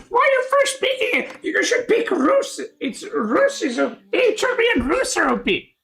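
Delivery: high-pitched whiny impression of the chat; mocking quote. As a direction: parody the nagging players. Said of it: "And people are like".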